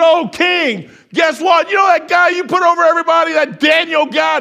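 high, full-voiced call to a king; loud tattling shout. Accusers reporting Daniel to the king, loud acted shout. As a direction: shout it up at the king like gleeful informers.

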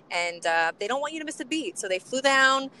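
usual speaking voice

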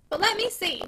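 a lady humouring a peddler; mildly patronizing request. Acted. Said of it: "still patronizing".

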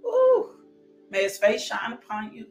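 normal talking voice

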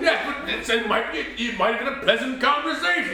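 high-pitched, exasperated defence; indignant argument. Acted. Father justifying why he held back, high and indignant. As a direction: argue back with the voice pushed up.

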